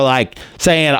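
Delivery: ordinary delivery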